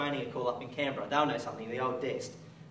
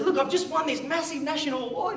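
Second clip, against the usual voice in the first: much higher, bursting with news; excited boast; 11 semitones higher than usual. Retold or imitated. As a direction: blurt out the big news to the teacher. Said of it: "and I got all excited".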